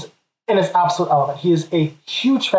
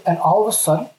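ordinary talk, nothing marked

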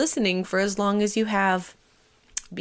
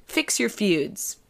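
normal talking voice

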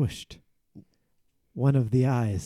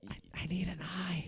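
hushed stammered whisper; voiceless, very soft plea; urgent whisper; 15 dB quieter than usual. Acted. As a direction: whisper it secretly to a colleague. Secretly asking a colleague for help.

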